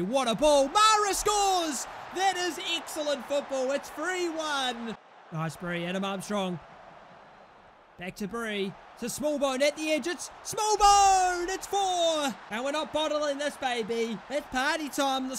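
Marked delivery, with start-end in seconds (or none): 0.0-2.4 loud, stretched goal call; raised, drawn-out excited yell; goal celebration shout. Real, not acted. Calling a goal. 10.1-12.3 loud, high, drawn-out goal call; long excited holler; goal shout. Real, not acted. Calling a goal.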